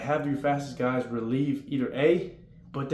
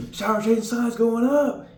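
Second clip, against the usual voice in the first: loud, high, slow called announcement; dorm call-out; 10 semitones higher, 8 dB louder, slower than usual. Retold or imitated. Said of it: "the E C guy's gonna go".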